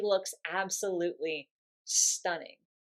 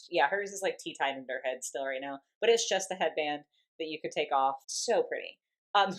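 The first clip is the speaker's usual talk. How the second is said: ordinary talk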